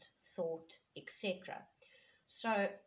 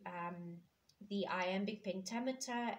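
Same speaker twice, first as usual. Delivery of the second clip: ordinary talk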